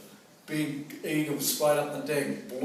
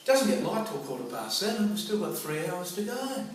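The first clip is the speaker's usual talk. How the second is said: ordinary talk, nothing marked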